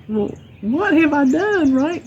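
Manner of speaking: high, drawn-out retold bewilderment; slow, high-pitched mock disbelief. Retells her own puzzled thought.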